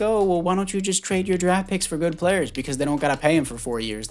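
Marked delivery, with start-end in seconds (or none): 0.0-2.5 lightly voiced know-it-all fan, higher; mock the people who ask this; a hint of a smug armchair fan; mocking imitation of critics. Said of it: "People are like".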